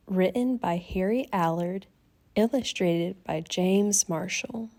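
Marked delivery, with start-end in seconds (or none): none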